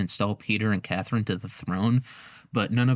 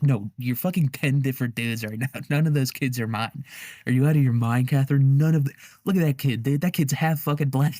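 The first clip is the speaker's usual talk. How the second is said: hammed-up indignant protest, higher pitch; outraged husband; 4 semitones higher, 4 dB louder than usual. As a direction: rant in mock disbelief, voice climbing. Imagined outraged husband disowning the children.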